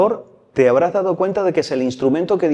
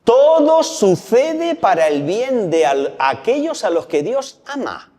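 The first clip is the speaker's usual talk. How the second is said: higher, slow and weighty scripture quote; solemn proclamation; 8 semitones higher, slower than usual. Real, not acted. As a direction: proclaim each word slowly. Cita bíblica proclamada lentamente. (cita proclamada despacio, más aguda y solemne)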